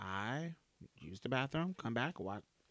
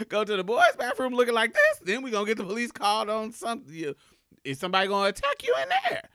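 laughing; 7 semitones higher, 8 dB louder, faster than usual. Retold or imitated. Laughing, retelling officer.

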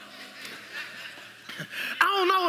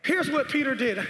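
ordinary talk

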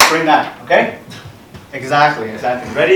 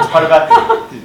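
giggling through the words; high laughing repeat; 13 semitones higher, 3 dB louder than usual. Real, not acted.

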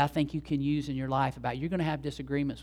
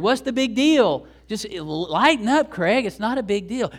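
laid-back dismissive; 5 semitones higher, 5 dB louder, slower than usual. Retold or imitated. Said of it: "others of us are great".

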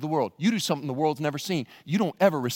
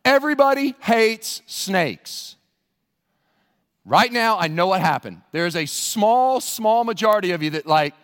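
ordinary talk, nothing marked

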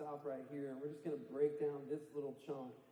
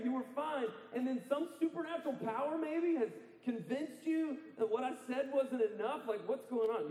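raised, driving preacher voice; preaching with full force; 7 semitones higher than usual. Acted. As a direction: preach it hard to the congregation. Preaching Paul's appeal.

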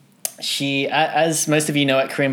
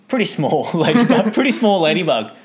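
higher and louder, laughing; 7 semitones higher, 6 dB louder than usual. Real, not acted. Telling of the tiny ladybug, laughing loudly. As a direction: laugh through the words.

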